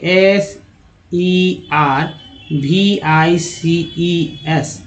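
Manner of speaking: S E R V I C E S spelled slowly; slow spelling out. Shown as a demonstration. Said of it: "ई जो है अ के रूप में उच्चारण हुआ". (धीरे-धीरे स्पेलिंग)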